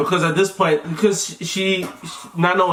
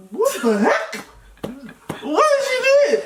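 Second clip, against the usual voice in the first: shocked. Retold or imitated. Panicked partner retelling own reaction.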